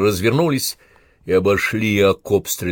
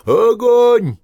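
very high, very loud order; commander's piercing battle yell; shouted command; 9 dB louder than usual. A narrator's reading. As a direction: shout the firing order with full force. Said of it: "командовал Иванцов".

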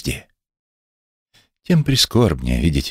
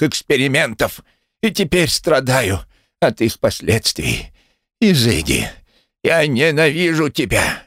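wet, gurgling cry from broken lungs; gloopy bubbling slime voice; 7 semitones higher than usual. Acted. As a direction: bubble the words out like bursting honeycomb. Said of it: "возопил булькающим голосом, как будто воздух в его лёгких прорывался".

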